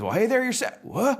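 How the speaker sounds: very high folksy truck returning hello; startled greeting. Acted. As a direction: greet back, then yelp in surprise. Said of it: "our Mater says".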